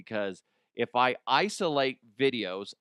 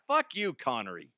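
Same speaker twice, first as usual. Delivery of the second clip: ordinary talk, nothing marked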